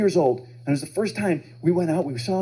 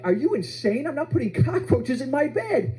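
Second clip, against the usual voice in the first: high-pitched, incredulous protest; appalled disbelief; 8 semitones higher, slower than usual. Retold or imitated. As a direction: refuse in horrified disbelief, voice pitched up. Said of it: "that was my reaction".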